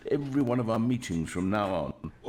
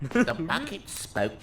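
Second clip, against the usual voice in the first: slow, trying out a bucket voice; testing a silly object voice; 4 semitones higher, slower than usual. Shown as a demonstration. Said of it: "I'll figure it out on the fly".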